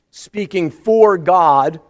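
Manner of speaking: ordinary talk, nothing marked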